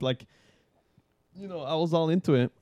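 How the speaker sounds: ordinary delivery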